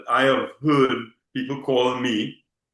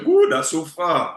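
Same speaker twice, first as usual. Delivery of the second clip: mock dismay; 4 semitones higher, 5 dB louder than usual. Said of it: "The normal refrain was".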